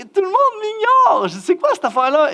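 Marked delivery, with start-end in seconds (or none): 0.0-1.3 high-pitched mock outcry; indignant outburst. Guest speaker ignored by everyone at camp, indignant outburst. 1.4-2.3 higher, exasperated retold protest; mock indignation. Ignored guest protesting to himself, mock indignation.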